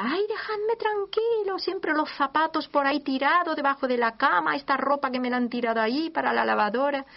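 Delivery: mother scolding the family about shoes and laundry, hammed-up shout; frazzled mother shouting. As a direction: play the fed-up mother yelling at the kids. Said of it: "Que grita".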